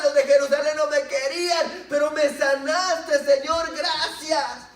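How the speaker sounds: grito agudo y fuerte del leproso sanado; gritando de gratitud. Acted. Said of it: "en presencia de Jesús gritando". (el leproso sanado le grita gracias a Jesús)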